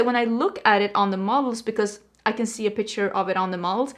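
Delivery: ordinary delivery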